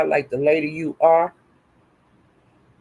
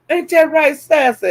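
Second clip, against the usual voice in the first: taunting; 8 semitones higher than usual. Acted. Taunting chant, higher.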